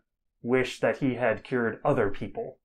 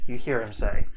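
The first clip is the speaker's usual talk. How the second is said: ordinary talk, nothing marked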